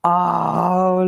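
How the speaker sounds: ordinary talk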